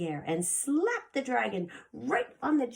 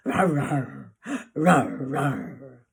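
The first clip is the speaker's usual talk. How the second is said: dragon rawr; 6 semitones lower, 8 dB louder, slower than usual. Acted. Sad dragon crying out.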